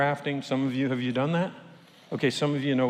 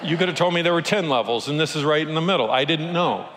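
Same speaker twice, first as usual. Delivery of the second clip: ordinary delivery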